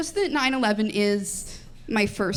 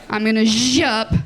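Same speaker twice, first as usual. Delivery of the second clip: blender noise; 4 dB louder than usual. Pretend. Mimicking a blender sound.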